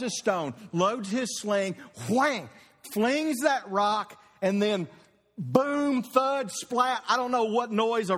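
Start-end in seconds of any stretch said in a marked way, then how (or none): 2.1-2.5 a sharp sling whang. Acted. Preacher voicing the sling, a sharp whang. Sling sound effect in story. 5.4-6.0 boom, high and punchy; rock impact boom. Shown as a demonstration. Preacher acting the stone striking Goliath, a punchy high boom. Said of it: "but there you hear it".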